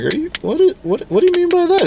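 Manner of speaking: high, stammering mock alarm; baffled disciple. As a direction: fumble the question in squeaky disbelief. Acting baffled Peter questioning Jesus.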